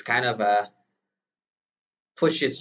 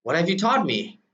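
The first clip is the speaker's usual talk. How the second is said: angry; 7 semitones higher than usual. Retold or imitated. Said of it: "Very, very angry".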